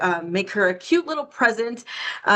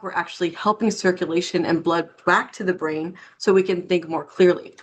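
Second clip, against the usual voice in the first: ordinary talk, nothing marked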